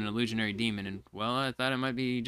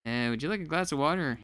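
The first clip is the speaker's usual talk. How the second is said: ordinary talk, nothing marked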